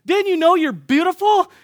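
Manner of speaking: high, loud call to a passer-by; excited retold yelling. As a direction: call it out joyfully to everyone you see. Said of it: "I'm yelling".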